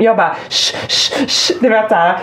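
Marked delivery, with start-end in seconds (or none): 0.5-1.5 repeated voiceless hush; urgent "shh" in short bursts; sharp shushing. Retold or imitated. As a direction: shush a ranting child. Hushing the complaining child.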